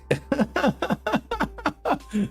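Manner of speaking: high giggle; high burst of laughter. Real, not acted. Laughing at own joke.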